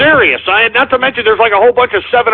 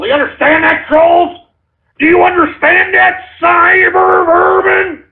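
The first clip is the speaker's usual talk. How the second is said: angry; 7 semitones higher than usual. Real, not acted. Host yelling at trolls.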